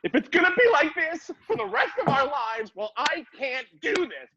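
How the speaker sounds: overwrought high-pitched protest; mock dramatic plea. Partner pleading in a mock breakup, hammed-up distress.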